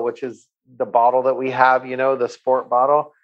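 ordinary delivery

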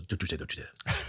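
quick, soft, almost voiceless warning; hushed warning. Retold or imitated. Retelling a movie scene to a co-host, fast breathy hush. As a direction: hiss it out fast under your breath.

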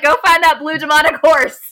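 loud, high, hyped-up retelling; excited urging. Retold or imitated. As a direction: send a friend on a mission, loudly. Acting out her excited urging.

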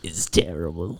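low, voiceless whisper; whispered disgust. Pretend. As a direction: whisper "terrible" in mock despair. Mock-disgusted repeats of one verdict.

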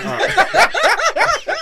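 high squealing laughter; laughing hard. Real, not acted. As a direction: burst out laughing. Laughing fit after near-sneeze.

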